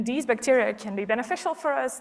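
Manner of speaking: ordinary delivery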